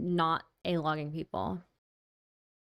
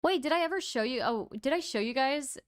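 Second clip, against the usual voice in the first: ordinary talk, nothing marked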